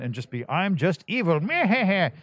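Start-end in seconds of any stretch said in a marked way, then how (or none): 0.5-1.4 lightly voiced cartoon villain, higher and a bit louder; hammy villain boast. Voice actor demos a one-dimensional villain, hammy evil boast. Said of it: "You can just let rip". 1.4-2.1 high, hammed-up evil cackle; a short gleeful bad-guy laugh; evil villain chuckle. Voice actor caps his villain demo with a hammy evil chuckle. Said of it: "You can just let rip".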